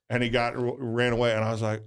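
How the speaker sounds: ordinary talk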